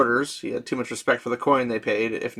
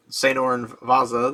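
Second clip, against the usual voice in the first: usual speaking voice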